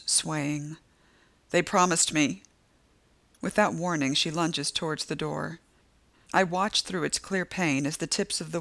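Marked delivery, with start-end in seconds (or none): none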